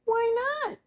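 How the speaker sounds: put-on very high, tiny chorus of baby swans; cygnets crying out. A narrator's reading. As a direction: squeak it like a startled cygnet. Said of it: "cried all the cygnets".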